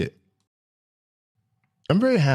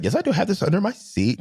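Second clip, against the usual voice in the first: acted reply; 8 semitones higher than usual. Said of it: "And I was like".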